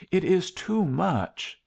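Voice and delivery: high, drawn-out protest. A narrator's reading. Young woman refusing his sacrifice, high slow protest. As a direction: object earnestly, stretching the words out. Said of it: "even while she protested".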